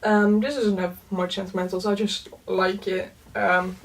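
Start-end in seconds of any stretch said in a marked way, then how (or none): none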